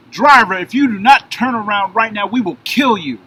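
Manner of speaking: loud, high commanding shout; armed cop yelling a warning; police shouting. Retold or imitated. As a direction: bark orders like a cop with a gun drawn.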